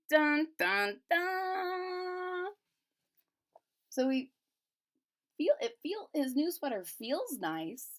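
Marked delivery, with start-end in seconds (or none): 0.1-2.5 higher sung dun dun dun, last note held; dramatic sting. Pretend. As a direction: hum a mock suspense jingle. Dramatic sting after Harry's decision.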